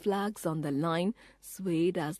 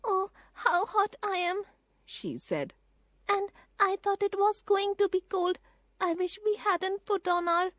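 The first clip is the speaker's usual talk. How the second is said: put-on high, breathless-sounding girl; a hot, fretting little girl; girl Phyllis, flustered; 14 semitones higher than usual. A narrator's reading. Phyllis complaining about the heat, high and flustered.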